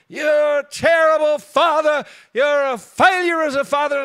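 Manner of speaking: high, loud, drawn-out mock yell; imitated yelling. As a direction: holler it slowly like an angry neighbour across the road. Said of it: "I hear she's yelling about".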